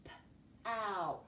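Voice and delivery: "P-ow" split, p then long ow; sounding out. Shown as a demonstration. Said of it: "Where do we hear it?".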